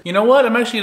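high, loud burst; excited. Real, not acted. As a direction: blurt it out suddenly. Sudden high exclamation.

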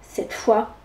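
plain reading voice

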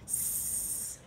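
soft, long unvoiced hiss; playful sustained snake sound. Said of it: "I'm a snake".

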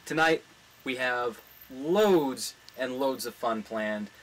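ordinary delivery

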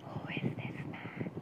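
unvoiced whisper, lips barely moving; hushed secret whisper. Shown as a demonstration. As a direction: breathe it out with no voice. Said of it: "See, if I am saying like this".

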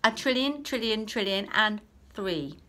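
high, loud outbid; smug answer. Acted. Clever boy one-upping again, loud and cheeky. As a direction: keep topping it cheekily. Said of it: "boy keeps outbidding".

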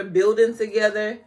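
ordinary delivery

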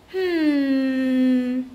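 long, drawn-out thinking hum; pondering hum. Acted. Siblings think up a scare, long pondering hum. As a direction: hum while hatching a plan.

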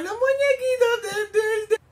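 high, slow, teary fangirl whine; whimpering squeal. Real, not acted. Fan melting over the little figurines, high teary whimper.

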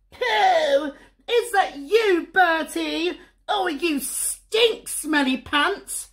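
whiny sister, drawn out slowly on the insults; sniffy big sister. Acted. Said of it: "sniffed Susie".

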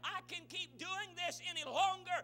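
loud, high, building sermon delivery; anguished preaching plea. Acted. Struggling believer pleads with preacher, impassioned shout. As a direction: pile up the pleas at full force.

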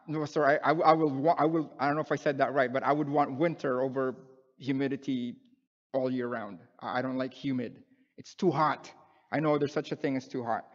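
ordinary delivery

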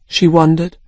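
ordinary delivery, nothing marked

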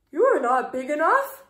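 loud, flustered brush-off; blurted loud excuse. A narrator's reading. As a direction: bluster out of the fight. Ladybug backs down loudly.

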